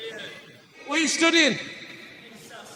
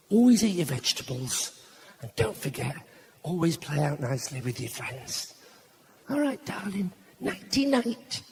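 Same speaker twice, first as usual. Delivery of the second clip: acted goodnight, growing softer as it goes; soft, loving tuck-in voice; tender bedtime parent; 3 semitones lower, 5 dB quieter than usual. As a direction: soothe a child toward sleep, voice fading gently. Parent tucking daughter into bed.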